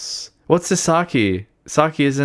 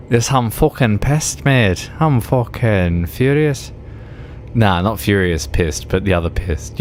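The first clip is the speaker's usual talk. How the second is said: ordinary delivery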